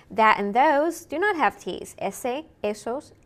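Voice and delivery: usual speaking voice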